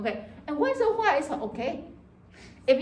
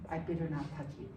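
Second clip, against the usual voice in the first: timid roommate aside; 7 semitones lower, 10 dB quieter, much faster than usual. Retold or imitated. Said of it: "your roommate say".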